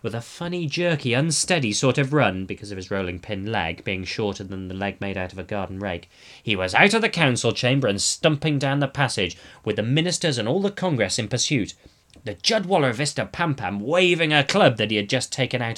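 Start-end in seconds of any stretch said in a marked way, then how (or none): none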